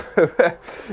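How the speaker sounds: short high laugh; giggling at his own silly joke; burst of laughter. Real, not acted. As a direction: laugh it off, embarrassed.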